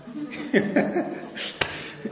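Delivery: breathy, unvoiced chuckle; real amused chuckle at himself; a short run of breathy laughter. Real, not acted. Laughs at his own Ferrari confession.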